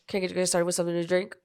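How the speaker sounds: fast, flat rattled-off line; just get it over with; rushed server. Shown as a demonstration. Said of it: "if I'm in a mood".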